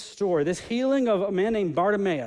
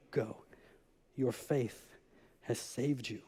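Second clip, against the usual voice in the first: Jesus blessing; 7 semitones lower, 10 dB quieter, slower than usual. Acted. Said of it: "Jesus said to him".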